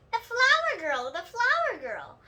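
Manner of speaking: excited. A narrator's reading. Lucille excitedly explains flower girl.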